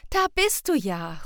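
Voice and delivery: put-on calling out loud to a found child; relieved call. A narrator's reading. Mother Anja finding Sam outside, calling out loud with relief. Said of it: "ruft Anja".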